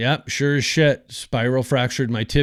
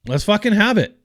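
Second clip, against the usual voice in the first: higher, fired-up "let's have it"; pumped-up retort; 9 semitones higher than usual. Retold or imitated. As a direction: throw it back at him, ready to go. Retold defiant answer to the officer.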